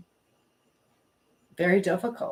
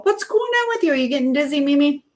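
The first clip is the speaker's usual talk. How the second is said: much higher, lilting voice; high-pitched fussing over someone; playful concern; 6 semitones higher, 3 dB louder, faster than usual. Real, not acted. Host checking on Mimi after her near collision, playful concern.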